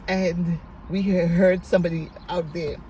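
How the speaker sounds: normal talking voice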